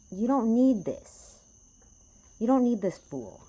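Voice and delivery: loud, high, slow insistence; urgent plea. Real, not acted. Urging viewers away from fools.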